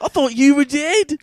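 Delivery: high, loud disbelieving cry; stunned son. Retold or imitated. Son confronting a father thought dead, stunned.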